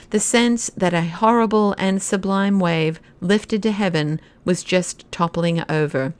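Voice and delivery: ordinary delivery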